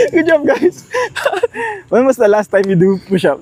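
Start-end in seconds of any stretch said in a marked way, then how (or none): 0.0-1.9 high, giddy laughing praise; laughing cheer. Real, not acted. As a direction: cheer them on through laughter. Cheering after push-ups.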